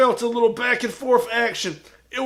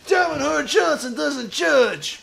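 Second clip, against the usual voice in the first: wrestler promo; slower than usual. Acted.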